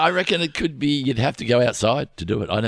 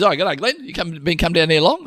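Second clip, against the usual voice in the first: higher, friendly servo bloke; mate chatting at the petrol station; 7 semitones higher than usual. Retold or imitated. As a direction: chat like a friendly local. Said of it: "He goes".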